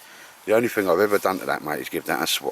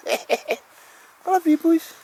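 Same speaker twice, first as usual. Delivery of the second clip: dog's voice; much slower than usual. Pretend. Owner voicing his dog Baldrick to Al, high squeaky dog voice. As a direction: speak for the dog in a squeaky falsetto. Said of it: "He says".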